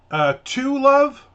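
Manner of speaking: mock waitress greeting, jumping high and loud on LOVE; insincere endearment. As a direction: imitate a falsely sweet waitress. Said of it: "it just sounds so insincere".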